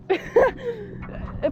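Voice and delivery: high-pitched burst of laughter; long, high giggle; giggling fit. Real, not acted. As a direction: crack up at a friend's joke. Laughing at friend's joke.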